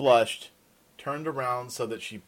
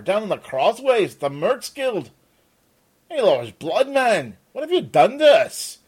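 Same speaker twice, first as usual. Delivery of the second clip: rising to an angry, louder, higher accusation; accusing; 7 semitones higher, 9 dB louder than usual. Acted. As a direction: corner him, building to fury. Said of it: "Peter turned on the Arendani".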